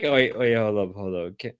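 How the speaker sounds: ordinary delivery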